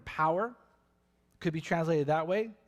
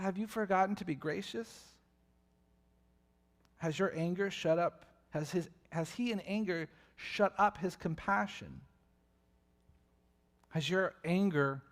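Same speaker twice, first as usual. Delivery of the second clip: ordinary delivery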